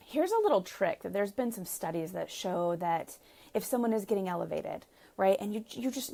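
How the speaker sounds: ordinary talk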